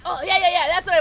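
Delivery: loud, high burst of delight; real glee; excited. Real, not acted. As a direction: yelp it out, thrilled. Excited at getting the move.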